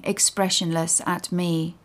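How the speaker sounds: ordinary delivery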